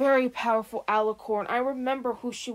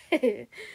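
evil chuckle. Acted. Villain gloating laugh.

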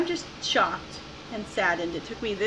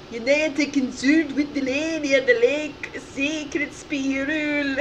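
louder, higher Scottish burr, last words drawn out; Scottish accent; 5 semitones higher, 4 dB louder, slower than usual. Acted. Player reading out the game's Scottish-spelled line, Scottish accent. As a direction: read it out in a hammed-up Scottish accent. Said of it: "He's talking in a Scottish accent".

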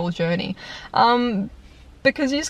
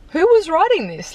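exasperated outburst; 10 semitones higher, 3 dB louder than usual. Real, not acted. Ranting about bad battle typings.

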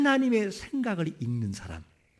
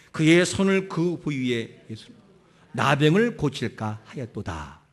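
scripture reading; 5 semitones lower than usual. Acted. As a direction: read the verse deliberately, with weight. Reads scripture aloud. (성경 본문을 천천히 낭독하듯)

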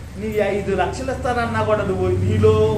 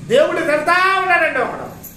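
emphatic shout; 8 semitones higher, 5 dB louder than usual. Real, not acted. Loud emphatic preaching line.

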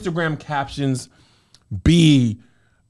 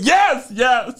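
high, strained reassurance; mock holding back tears; 6 semitones higher than usual. Teacher answering whether they are good, mock holding back tears. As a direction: insist it through mock tears. Denies crying to the kids.

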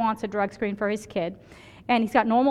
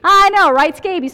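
exclaiming; 9 semitones higher, 12 dB louder than usual. Real, not acted. Punchline of the scabies story.

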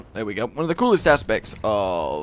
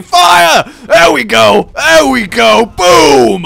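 loud excited shouts; shouting; 10 semitones higher, 13 dB louder, much slower than usual. Real, not acted. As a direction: yell over the battle. Cannon volley.

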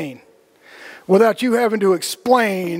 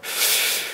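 long exhale; 7 dB quieter than usual. Retold or imitated. Smoker exhaling deeply.

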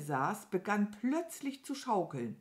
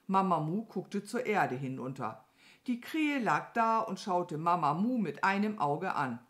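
usual speaking voice

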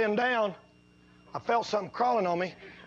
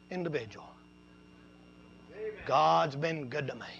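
low, soft, hushed retelling; hushed testimony; 8 semitones lower, 5 dB quieter than usual. Acted. Preacher quietly recounting hard winters to his church, in a low hush. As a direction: lower the voice and draw them in.

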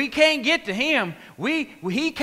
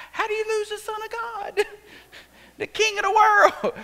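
much higher, laughing through it; laughing disbelief; 11 semitones higher than usual. Real, not acted. Preacher laughing at losing the Son of God, high and amused. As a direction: marvel with a laugh.

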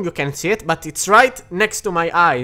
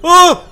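sharp, high startled yelp; startled scream; 11 semitones higher, 8 dB louder than usual. Real, not acted. Gamer startled by a ghost, high scream. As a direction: jump out of your skin. Jump scare reaction.

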